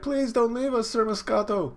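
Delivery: put-on very high child voice; high, mock-tearful child's plea; pleading kid. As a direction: beg in a little kid's falsetto. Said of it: "one of the kids like".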